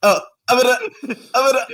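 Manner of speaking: high, loud, halting attempts to speak; mock someone failing to start a point; imitating false starts. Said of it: "Sean tries to start one point, like, nine times".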